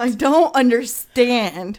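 loud, drawn-out confusion; real bewilderment. Real, not acted.

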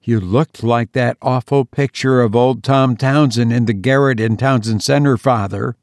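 plain reading voice

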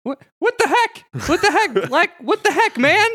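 very high, cracking cartoon protest; indignant cartoon sidekick. Shaggy is hit by a pumpkin.

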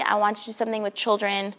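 usual speaking voice

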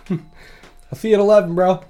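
mock buddy voice. Imitating Dusty leaving.

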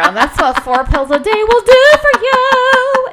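high, loud laughing joke. Real, not acted. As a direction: crack up through the line. Joking about her pills.

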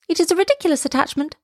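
high, quick bird chatter; twittering swallows. A narrator's reading. Other swallows judging his courtship, twittering. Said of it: "twittered the other swallows".

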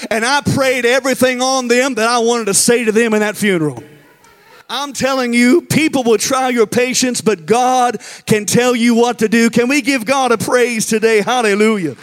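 loud, driving preacher's cadence; full-force preaching. Acted.

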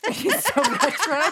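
slow, giggling repeat of the word retro; teasing a friend's word choice, laughing; laughing mock echo. Real, not acted. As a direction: echo it back through laughter, drawn out. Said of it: "I'm just kidding".

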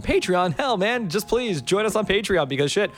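energized Patreon pitch. Shown as a demonstration. Host redoing the Patreon plug, energized. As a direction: sell it with big energy. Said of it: "as I'm doing right now".

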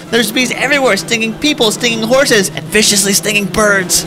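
frantic, high, loud commentary; shrieking as the parade falls apart; panicked commentators. Acted. Parade float chaos.

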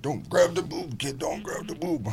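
high mock warning to the kid; mock scolding dad. Dad retelling warning his son, in a high mock voice. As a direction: playfully scold a grabby child. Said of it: "And I'm like".

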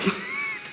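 a snort of suppressed laughter; stifled giggles. Real, not acted. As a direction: giggle held back through the nose. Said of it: "Was ist daran lustig?".

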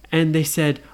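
ordinary delivery, nothing marked

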